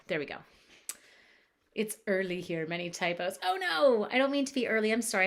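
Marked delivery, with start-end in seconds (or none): 3.4-5.3 high, loud "oh no"; dismayed protest. Real, not acted. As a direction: burst out in apologetic protest. Protesting a misunderstanding in chat.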